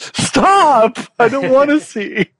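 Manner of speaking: very high, pleading mock panic; mock scream. Scared child begging the cameraman to stop, high mock scream. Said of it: "I'd scream at the cameraman".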